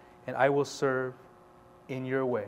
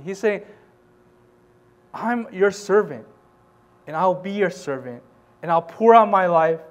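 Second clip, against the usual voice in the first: louder, higher passionate preaching; preaching at full force; 4 semitones higher, 5 dB louder than usual. Acted. Pastor urging his church to serve, full-force preaching. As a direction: drive the message home to the church.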